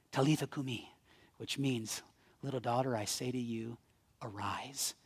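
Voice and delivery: very soft, low, reverent delivery; hushed storytelling. Acted. Jesus waking a child at her bedside, hushed storytelling. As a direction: drop almost to a whisper, tenderly.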